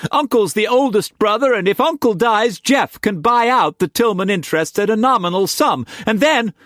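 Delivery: high and fast, words tumbling out; rushed, shaky. A narrator's reading. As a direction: rush on before anyone stops her. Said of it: "she rushed on shakily".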